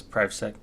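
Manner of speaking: PrivSec said as "prive-sek"; long-i pronunciation. Shown as a demonstration. Streamer puzzling over a name's pronunciation, PrivSec as "prive-sek". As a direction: say PrivSec with first vowel like "five". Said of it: "That's not how you pronounce".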